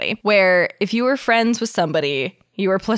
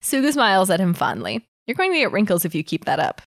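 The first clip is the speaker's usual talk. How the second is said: story narration. Acted. Reads fanfic aloud.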